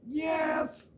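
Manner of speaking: wolf's granny voice. Acted. Wolf answering as Grandma.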